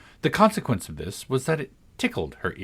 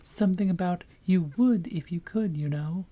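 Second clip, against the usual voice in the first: tiny voice; 8 semitones higher than usual. A narrator's reading. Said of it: "said the little voice".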